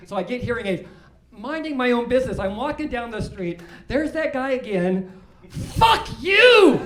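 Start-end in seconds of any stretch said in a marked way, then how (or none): 5.5-6.8 high, loud mock yell of a stranger; retold yell. The man outside the store, now clearly heard, yelling loud and high.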